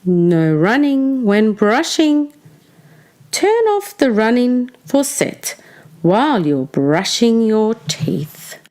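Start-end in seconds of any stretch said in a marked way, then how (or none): none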